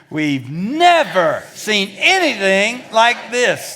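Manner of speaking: slow, loud, amazed; excited. Shown as a demonstration. Preacher showing congregation how amazed crowd said it. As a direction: say it with real spunk. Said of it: "You need a little Bronco mania here".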